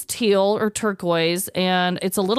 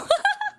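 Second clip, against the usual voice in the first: squealing giggle; a sudden high-pitched laugh; bright squeal of laughter. Real, not acted. Laugh about creepy coworkers.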